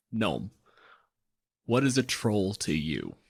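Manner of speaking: normal talking voice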